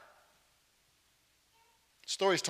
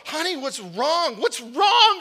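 higher, pleading "what's wrong", climbing up; a bewildered husband getting louder and higher; husband asks his sobbing wife what's wrong, rising to a louder, higher pitch; baffled husband; 8 semitones higher, 5 dB louder, slower than usual. Acted.

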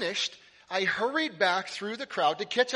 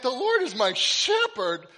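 "The Lord is my shepherd" read aloud higher, louder and slow in mock dismay; 6 semitones higher, 5 dB louder than usual. Pastor reenacting finding the misspelled card, mock dismay. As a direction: groan the line out like you just spotted the typo. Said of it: "And I went".

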